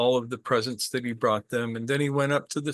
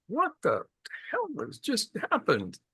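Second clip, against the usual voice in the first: high, stunned what-the-hell; stunned disbelief; 10 semitones higher, 3 dB quieter than usual. Retold or imitated. Merchant gaping at his dead bird, high shocked disbelief. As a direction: blurt it out in shock, pitched way up.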